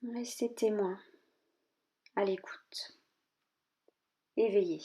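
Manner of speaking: measured yoga nidra guidance with long pauses; calm body-scan voice, leaving space after each instruction; guided meditation. Real, not acted. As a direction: guide them gently inward, phrase by phrase.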